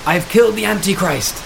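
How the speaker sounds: high mock yell. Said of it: "she yelled".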